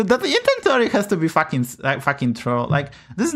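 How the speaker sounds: ordinary delivery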